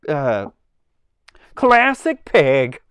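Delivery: very high, slow hammed-up character voice; high slow mock Target-lady introduction. Shown as a demonstration. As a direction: announce a name like a perky puppet. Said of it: "it does sound like the Target lady a little bit".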